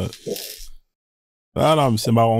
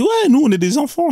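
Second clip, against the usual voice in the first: high, mocking imitation of the woman; mocking quote; 10 semitones higher than usual. Mocking the woman from the live, a high imitation. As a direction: mimic her childish claim, much higher. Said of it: "elle m'a dit".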